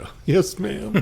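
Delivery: startled into a high, bashful reply; surprised high yes ma'am. Retold or imitated. Retelling when the patient's wife recognizes him, surprised high-pitched answer. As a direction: caught off guard, pitch jumps way up on oh yes ma'am.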